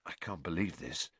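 low, soft mutter of disbelief. A narrator's reading. Harry stroking the broom, muttering in wonder. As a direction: mutter it under your breath. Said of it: "Harry muttered".

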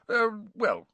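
high, hesitant stalling; nervous. A narrator's reading. Bagman dodging Harry's question about the goblins, nervous and hesitant. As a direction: stall with a touch of nerves. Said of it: "looking suddenly nervous".

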